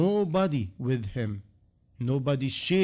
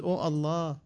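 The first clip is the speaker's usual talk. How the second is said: a mild crying plea; 4 dB quieter than usual. Retold or imitated. A snatched man cries out to Allah, mildly tearful. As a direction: call on Allah, lightly tearful. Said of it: "He cried and said".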